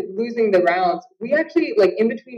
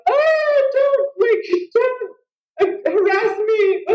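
much higher, hammed-up protesting voice; mock whiny man; 13 semitones higher, much slower than usual. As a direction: whine like a fussy fighter. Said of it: "some men that are like".

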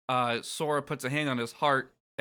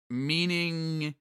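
drawn-out, puzzled higher question; confused hero; 4 semitones higher than usual. Acted. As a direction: voice a lost hero, pitched up. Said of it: "unsure what the fuck is going on".

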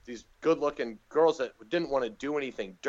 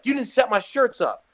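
higher, louder retold accusation; mock scolding; 9 semitones higher, 6 dB louder than usual. Star confronts the doorman after the show, scolding. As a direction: imitate an indignant complaint, high and loud.

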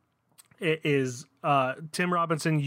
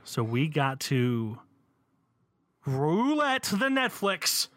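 drawn out; slower than usual. Acted. Hyping the game.

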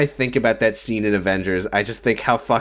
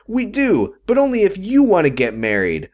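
higher, mock-earnest father; dad voice; 7 semitones higher than usual. Retelling the dad's lecture in a higher mock voice. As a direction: play the patient dad lecturing. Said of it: "And he goes".

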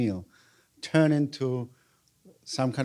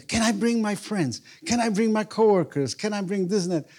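eager questions; 7 semitones higher than usual. Retold or imitated. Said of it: "girl asks eagerly".

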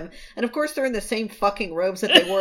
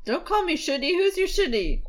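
mock bratty kid; 10 semitones higher than usual. Voicing child Jiang Cheng.

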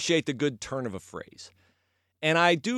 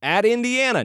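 ordinary talk, nothing marked

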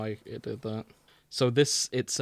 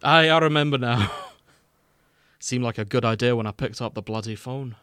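put-on Scottish accent; 4 dB louder than usual. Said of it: "Scottish accents".

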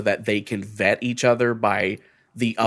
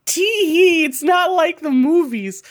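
high, giggly, coy sing-song; mock-innocent high giggle; coy teasing; 4 dB louder than usual. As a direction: tease them like a coy schoolgirl. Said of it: "me coyly saying for months".

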